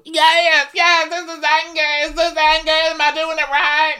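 high, loud hammed-up shouting of anger; mock yelling. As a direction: yell it like you're forcing anger on cue. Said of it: "you don't have to keep yelling".

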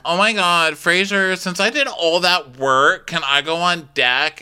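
imitating a spoiled crew member; whiny pleading. Camille begging Fraser to work on deck, whiny.

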